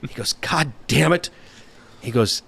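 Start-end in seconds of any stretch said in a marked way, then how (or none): 0.3-1.3 imitating a gruff boss, higher; mild mock outburst, repeated; gruff mock curse. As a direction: bark it like the scary mentor. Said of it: "he's kind of a scary dude".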